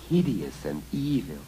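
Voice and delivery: usual speaking voice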